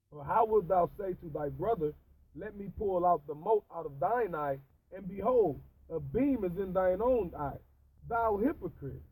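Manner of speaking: loud, solemn King James recital; scripture reading. Acted. Reading Bible verses aloud to a friend, loud and solemn. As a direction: proclaim the verse like a preacher.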